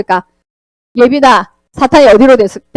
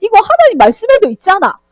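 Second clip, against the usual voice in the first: ordinary delivery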